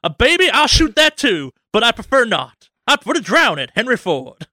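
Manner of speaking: high, loud hammy tycoon; Henry Ford voice. Acted. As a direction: boast like a cartoon villain tycoon.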